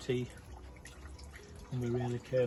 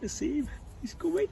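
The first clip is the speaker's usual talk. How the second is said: higher and higher with glee; rising excitement. Acted. Zacchaeus watching Jesus come, pitch climbing excitedly. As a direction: build the thrill as he comes closer.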